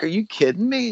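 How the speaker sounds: high-pitched incredulous question; retold disbelief. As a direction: replay your stunned reaction, voice pitched well up. Retold shock at news of death.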